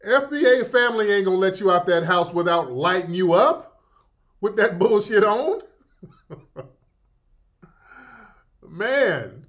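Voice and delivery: ordinary talk, nothing marked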